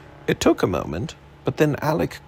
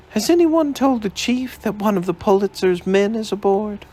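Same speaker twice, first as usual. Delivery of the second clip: higher, alarmed woman's voice; aghast society woman; 10 semitones higher than usual. A narrator's reading. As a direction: demand it in shocked alarm. Miss Rogers voiced, aghast.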